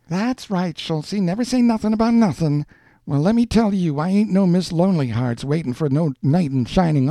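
high, indignant woman's rant; angry tirade. A narrator's reading. Woman tells man she needs no saving, angry defiance. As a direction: let it rip, high and emphatic.

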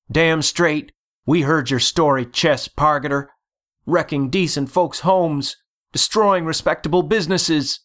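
high-pitched indignant accusation; accusing townsperson. A narrator's reading. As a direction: join the crowd's outcry, pitched high. Angry townsfolk accuse Chess.

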